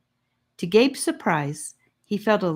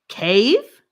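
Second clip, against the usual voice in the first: another captive; 5 semitones higher, 9 dB louder than usual. A narrator's reading. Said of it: "came another voice".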